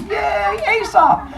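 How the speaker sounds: high-pitched, drawn-out mock cheer; voicing an excited crowd greeting its guest.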